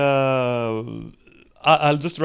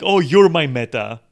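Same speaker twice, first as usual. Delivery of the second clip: mock swoon, much louder; 9 dB louder than usual. Clingy fan flirting with the streamer, loud mock swoon. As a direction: gush it like a love note. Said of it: "And she goes like".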